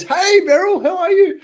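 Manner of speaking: high, loud phone hello; cheery greeting. Retold or imitated. Loud high cheery hello. Calls friend to share news.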